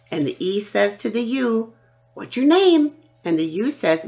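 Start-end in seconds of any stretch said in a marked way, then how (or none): 2.1-3.0 high and loud call out; playful loud question from the letter E; excited call. Pretend. E asks the vowel.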